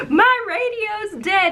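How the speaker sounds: high, slow plea; distressed report. Retold or imitated. Skipper telling Dennis her radio is dead.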